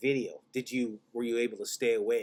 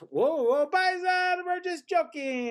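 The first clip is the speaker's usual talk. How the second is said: high, loud hammed-up Italian accent; Italian server; 6 dB louder, slower than usual. Server joking with the customers, hammed-up Italian accent. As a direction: laugh it off like a jovial Italian waiter. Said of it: "the guy goes".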